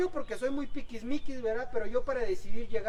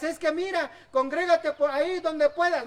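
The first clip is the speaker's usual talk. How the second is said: dismissive brush-off; 6 semitones higher, 6 dB louder than usual. Retold or imitated. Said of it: "No le digas".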